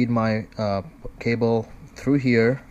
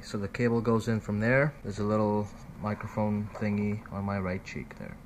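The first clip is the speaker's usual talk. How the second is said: ordinary talk, nothing marked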